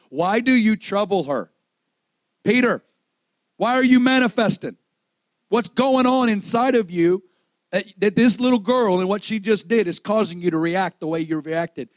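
high, loud confrontation as Jesus; challenging rebuke. Acted. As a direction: challenge the disciple with pressing questions. Acting out Jesus challenging Peter.

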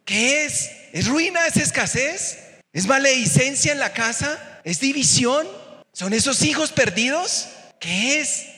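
high, fervent preaching. Acted. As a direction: rise up and call it out to the church. Preacher calling out questions.

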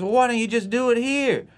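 put-on high, insistent kid-sister voice; mock big sister. Sister pressing her little brother, in a high kid voice. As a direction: pitch it way up like a pushy seven-year-old. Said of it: "And she goes".

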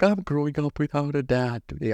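imitating a daughter's complaint; mock little-kid lament in a put-on voice. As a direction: play the neglected daughter, for laughs. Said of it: "Kissed my daughter. She's like".

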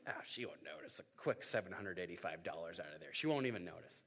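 sneaky aside. Pretend. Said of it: "I'm just like".

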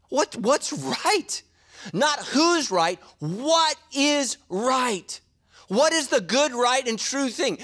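high, drawn-out preacher's emphasis; slow emphatic preaching. Acted. Pastor hammering home his point, slow and high. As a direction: stretch each word like a pulpit point.

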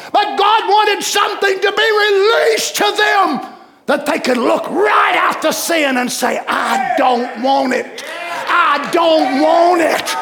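high, driving revival preacher; impassioned pulpit cry; full-force preaching. Real, not acted. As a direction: preach it hard, stretching the key words.

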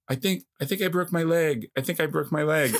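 high, alarmed repeated plea; retold panicked plea. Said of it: "and I'm like".

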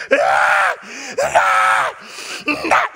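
loud fake cries of pain, hammed up; mock hollering. Preacher imitating a kid faking injury, mock hollering. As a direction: howl like a soccer flop. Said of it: "he starts hollering".

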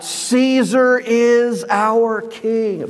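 a slow, lightly acted enraged crowd; mild mock rage. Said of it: "in a rage of madness".

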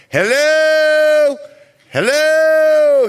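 high, loud sing-song hello; hammed-up yelling at the door; mock loud call. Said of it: "so he's not going".

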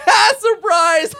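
high, loud cackling shout; Surprise Kid. Acted. Game master as laughing kid at gunk-covered victim, high cackling shout. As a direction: crow it through mocking laughter.

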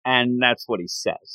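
usual speaking voice

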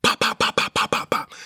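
rapid-fire popping gunshot noises; imitated gunshots. Storyteller mimics shots going through his Lexus, imitated gunshots. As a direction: mouth a burst of loud pops.

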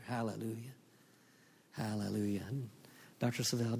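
ordinary delivery

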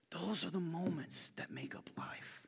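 slow, hushed whisper; soft slow whisper. Real, not acted. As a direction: lean in and whisper it. Sermon point.

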